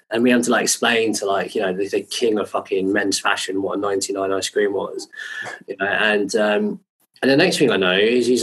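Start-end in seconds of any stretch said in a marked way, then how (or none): none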